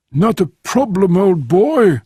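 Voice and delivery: high, mock English gentleman accent; perfect English accent. A narrator's reading. Frenchman agrees to play the Englishman, in high English accent. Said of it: "in a perfect English accent".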